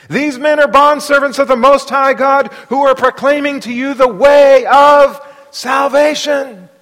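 loud, high shout, slowing at the end; crying out. Acted. Girl cries after Paul and Silas, high loud shout. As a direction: proclaim it like a street caller. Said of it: "crying out".

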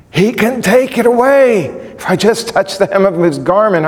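high-pitched, urgent burst of faith; urgent belief. Retold or imitated. Preacher voices the sick woman's thought, urgent belief. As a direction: cry it out with desperate hope. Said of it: "she might have thought".

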